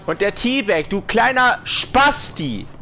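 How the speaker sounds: high, slow furious yell; berserk rage. Real, not acted. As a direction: scream insults at the enemy. Raging at teabagging enemy.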